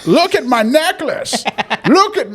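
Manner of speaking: rising into a high laughing squawk; cranky old man voice. Retold or imitated. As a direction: build to a shrill cackling boast. Parker impression escalating.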